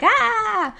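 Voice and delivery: high mock scream; long high "aah", falling; imitated scream. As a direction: imitate a roommate's scream of pain, toned down. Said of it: "I just heard him screaming".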